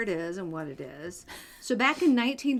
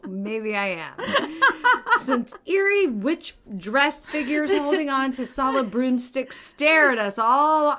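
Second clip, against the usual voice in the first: slow, louder cartoon villain reading; villain monologue; 5 semitones higher, 7 dB louder, slower than usual. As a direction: play the gloating villain, drawn out. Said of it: "You sound like a villain from, like, Despicable Me".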